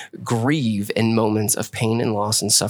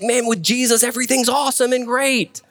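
high-pitched, hammed-up enthusiasm; mock upbeat sales pitch; overselling it; 10 semitones higher than usual. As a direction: gush like a too-cheery evangelist. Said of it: "you gotta tell them".

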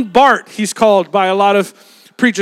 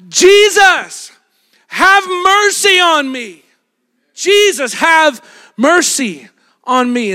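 pleading shout; 5 semitones higher, slower than usual. Retold or imitated. Blind beggar crying out to passing Jesus, high slow pleading shout. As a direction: call out to Jesus, begging him across the crowd. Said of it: "blind Bart starts shouting out".

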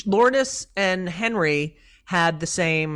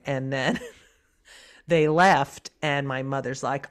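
ordinary talk, nothing marked

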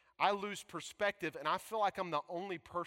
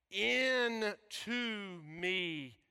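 drawn out word by word; very slow wordplay; much slower than usual. Shown as a demonstration. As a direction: let each word land slowly. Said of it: "catch this".

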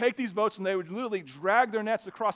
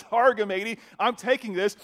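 mock pirate; 3 semitones higher, 7 dB louder than usual. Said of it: "the first pirate I know of".

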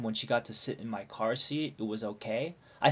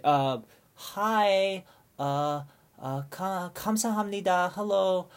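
higher, nervous fumbling voice; flustered caller; 6 semitones higher than usual. Retold or imitated. Calling the friend's mom at night, flustered and fumbling. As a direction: stammer awkwardly to a stranger's mom.

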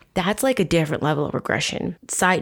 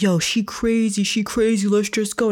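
slightly raised voice for the alarmed friend; urgent retold warning, a little higher; lightly voiced bystander; 4 semitones higher than usual. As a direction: lightly imitate a guy pulling his friend away. Voicing the other man backing off.

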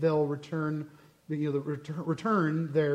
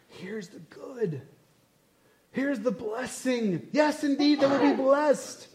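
drawn-out, high, playful proclamation, building in pitch; grand offering; 7 semitones higher, slower than usual. Acted. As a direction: hold out each gift slowly, rising higher. Voices God offering blessing.

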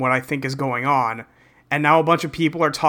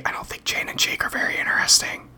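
whispering; 5 dB quieter than usual. Real, not acted. Confiding a dull opinion.

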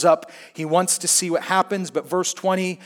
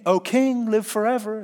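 higher, sly teasing "O king, live forever"; sly, half-joking greeting to the king; teasing rendition; 5 semitones higher than usual. Retold or imitated. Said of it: "That's just Ashoff's rendition".

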